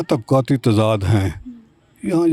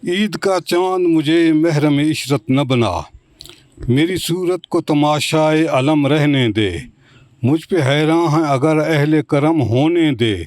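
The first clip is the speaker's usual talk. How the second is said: stately nazm recitation; 4 semitones higher than usual. Shown as a demonstration. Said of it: "ایک نظم پیش کر سکتا ہوں".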